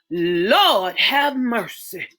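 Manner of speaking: slow, hushed in shock; stunned. Acted. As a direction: let it fall out slowly. Mother stunned at doorway.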